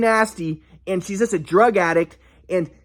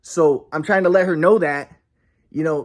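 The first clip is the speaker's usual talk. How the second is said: usual speaking voice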